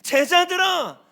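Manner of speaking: impassioned high shout, drawn out; Jesus. Retold or imitated. Preacher as Jesus urging the disciples, impassioned shouting. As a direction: cry it out at full force, stretching the words. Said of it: "예수님이 말씀하시는 것 같아요".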